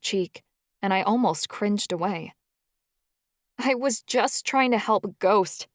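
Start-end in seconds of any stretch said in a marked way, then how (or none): 3.6-5.7 higher, slow pleading. A narrator's reading. Pidge pleading with Charlie to understand, slow and higher. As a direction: beg him slowly to understand. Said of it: "I pleaded".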